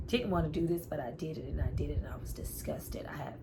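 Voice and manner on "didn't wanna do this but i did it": ordinary talk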